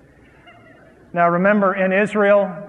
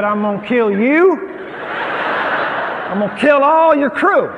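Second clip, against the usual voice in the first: louder and higher, hammed-up threat with a laugh in it; mock furious threat; 6 semitones higher, 5 dB louder than usual. Husband threatening ship's bursar over luggage, hammed-up shouting. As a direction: play-act a traveller losing it at the purser.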